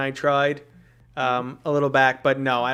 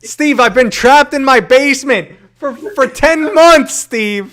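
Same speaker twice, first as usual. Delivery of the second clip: louder, higher, hammed-up begging with a slow drawn-out "months"; mock desperate plea; 12 semitones higher, 11 dB louder, slower than usual. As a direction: plead like a prisoner crying for rescue, over the top. Joking desperate plea to friend.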